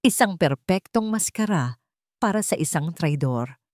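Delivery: plain reading voice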